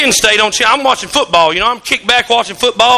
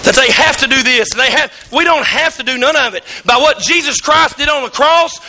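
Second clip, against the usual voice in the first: ordinary delivery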